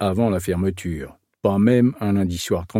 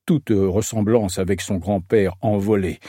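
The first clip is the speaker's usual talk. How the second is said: usual speaking voice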